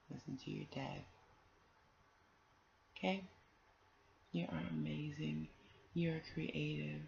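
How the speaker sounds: tuck them in with your voice; tender goodnight. Real, not acted. Reader wishing the children goodnight, soft and tender.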